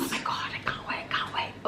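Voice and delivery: soft, voiceless, eager whisper; excited whisper. Real, not acted. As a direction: whisper it, thrilled about the food. Excited aside about the food.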